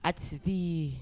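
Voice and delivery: put-on French accent; halting, amateur French accent. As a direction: attempt a playful French accent. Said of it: "I can only do a French accent".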